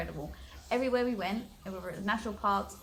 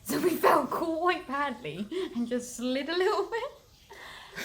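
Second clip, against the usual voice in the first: laughing; 6 semitones higher, 4 dB louder, slower than usual. Real, not acted. Laughing while telling fall.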